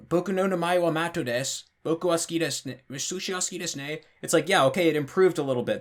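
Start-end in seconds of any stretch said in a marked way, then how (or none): 0.1-4.0 put-on foreign-accented Japanese with the swoops held level; clumsy beginner's Japanese, trying to keep the pitch even; learner attempting flat Japanese. Showing a learner who was told to speak flat, foreign accent in Japanese, evened out. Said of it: "trying to keep it flat".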